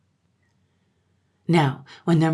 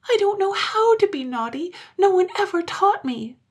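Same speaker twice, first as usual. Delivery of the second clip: put-on very high, loud little-girl voice; high childish dismay; child's lament; 4 dB louder than usual. A narrator's reading. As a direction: wail it like a helpless child. Blue Eyes, a child, laments.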